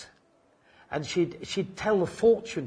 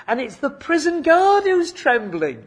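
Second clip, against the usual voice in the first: usual speaking voice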